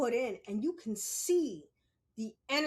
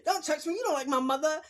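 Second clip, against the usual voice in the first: high, loud laughing mock yell; retold childhood outburst, yelling with laughter; 7 semitones higher, 6 dB louder than usual. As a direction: lash out at her, half laughing. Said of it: "I was like".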